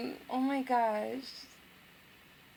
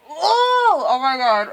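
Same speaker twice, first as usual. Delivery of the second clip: loud, high squeal breaking into laughter; fangirl squeal; 11 semitones higher, 13 dB louder, slower than usual. Real, not acted. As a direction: burst out in delighted shrieks. Squealing at tender in-game moment.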